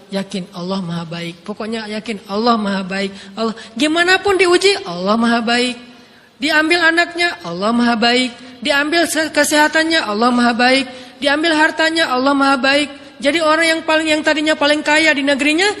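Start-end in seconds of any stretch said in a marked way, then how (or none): 3.4-12.9 fervent preaching. Acted. Preaching refrain, rising fervour.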